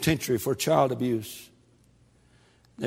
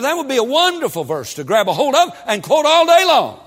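high, loud ecstatic preaching; preaching shout; 12 semitones higher, 10 dB louder than usual. Acted. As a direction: lift the verse up with full force.

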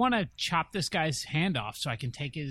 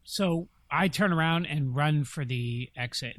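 usual speaking voice

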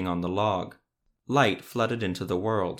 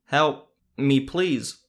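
shouting for help; 4 semitones higher, 6 dB louder, much slower than usual. A narrator's reading. Cry for help in forest.

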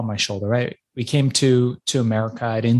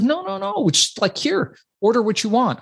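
put-on high, easy-going kid brushing off worry; breezy rich kid; 11 semitones higher than usual. Rich kid waving off his hungry friend, breezy and high. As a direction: toss it off like it's nothing, pitched up. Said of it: "He's like".

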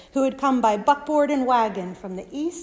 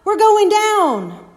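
loud panicked shout, much higher; 11 semitones higher, 11 dB louder than usual. Acted. Disciple shouting to wake sleeping Jesus, loud panicked shout. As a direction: yell it over the storm. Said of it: "Until someone shouts".

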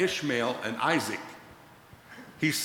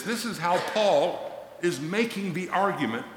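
usual speaking voice

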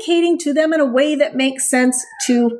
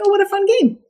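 voicing the dog; 7 semitones higher, faster than usual. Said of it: "I'm like".

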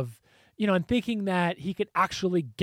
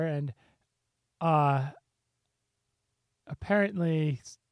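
normal talking voice

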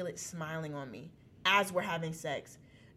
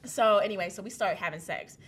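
ordinary delivery

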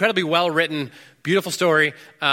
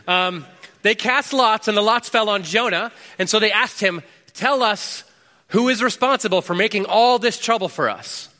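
urgent reading; 6 semitones higher than usual. Acted. Reading sailors' panic.

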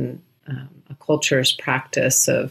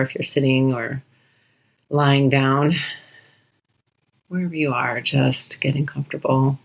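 unhurried meditation guide with long pauses between phrases; guided meditation. Real, not acted. Host guides listeners through a short body scan, guided meditation voice. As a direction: guide listeners gently through the body, leaving space to notice.

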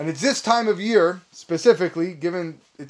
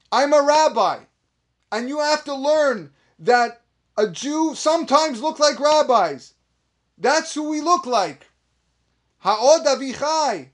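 higher and louder, proclaiming proudly; rising preacher's call; 7 semitones higher, 3 dB louder than usual. Pretend. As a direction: announce it like a fiery preacher to the kids. Imagined rabbi preaching to children.

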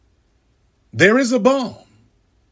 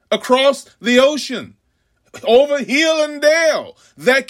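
higher, intoned sermon build; preaching; 8 semitones higher than usual. Acted. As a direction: climb like a preacher at full force.

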